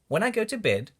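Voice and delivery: plain reading voice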